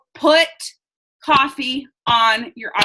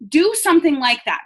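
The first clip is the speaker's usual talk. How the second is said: ordinary talk, nothing marked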